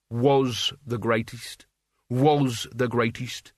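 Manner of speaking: slow, weighty WAS, drawn out each time; stress on WAS. A narrator's reading. Albert corrects Mort's praise, stretching "Was". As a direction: lean long on "Was" to correct the tense.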